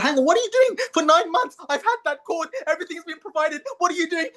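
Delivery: put-on high, indignant baby voice; the outraged newborn asking what's going on. Newborn questioning the doctor cutting its cord, high and indignant.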